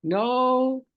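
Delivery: "No" drawn out, high and loud; emphatic NO. Real, not acted. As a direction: stretch "No" into a loud, rising word. Rereads the line for emphasis.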